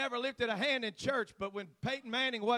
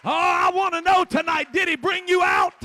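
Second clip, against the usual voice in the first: shouted sermon, much higher and louder, slowing on the last lines; preaching at full force; 11 semitones higher, 18 dB louder than usual. Acted. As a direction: hammer home the victory.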